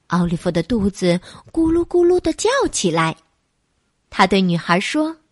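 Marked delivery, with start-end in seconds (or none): none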